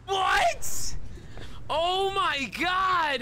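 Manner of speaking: high, startled yell; surprised shout. Real, not acted. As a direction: shout it in disbelief. Surprised game moment.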